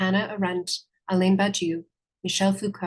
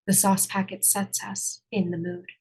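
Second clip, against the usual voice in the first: sultry narrator. Acted. Lover's whisper scene.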